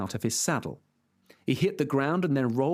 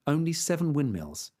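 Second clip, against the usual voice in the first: plain reading voice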